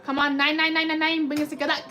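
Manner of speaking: ordinary delivery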